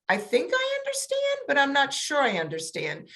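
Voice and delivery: the voice leaps high on "I think I understand", then settles back; uncertain intonation. Shown as a demonstration. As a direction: sound unsure so the child knows to adjust. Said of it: "change your intonation to let them know".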